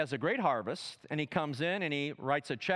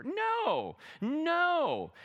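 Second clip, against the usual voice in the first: high, emphatic denial; 12 semitones higher than usual. Real, not acted. Preacher to congregation, sharply rejecting a wrong view. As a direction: cut off the wrong idea.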